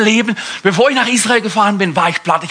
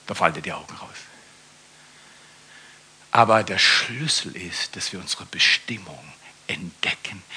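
hushed telling; 9 semitones lower, 7 dB quieter than usual. Real, not acted. Leise, vertrauliche Erzählung.

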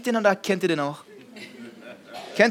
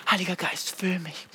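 quiet, tender plea; hushed sincere prayer; soft prayer; 5 dB quieter than usual. Acted. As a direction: pray it gently, almost to yourself.

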